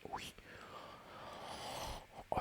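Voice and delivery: ordinary talk, nothing marked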